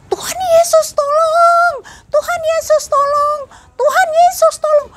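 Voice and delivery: high, shrieking cry for help, near sobbing; panicked crying scream. Retold or imitated. Mother at crash site shrieking to Jesus for help, crying. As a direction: scream to Jesus as if in terror, voice cracking. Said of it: "Saya sampai teriak-teriak".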